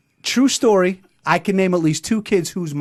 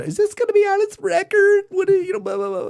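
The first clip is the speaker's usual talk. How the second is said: much higher fretful mom voice; the mother butting in, pitched way up; imitating mother. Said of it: "just interrupts her".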